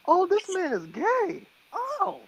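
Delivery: very high, slow, drawn-out squeal of disbelief; shocked squeal. Retold or imitated. To his friend who just came out, a high, slow, stunned squeal. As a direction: shriek it like the penny just dropped.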